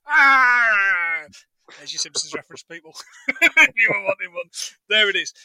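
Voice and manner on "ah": sudden loud yelp of pain; loud cry. Retold or imitated. Fan finishing the Simpsons quote with a yelp. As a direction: yell out as if hurt.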